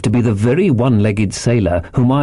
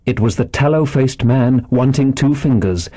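usual speaking voice

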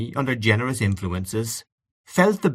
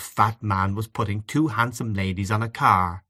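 plain reading voice

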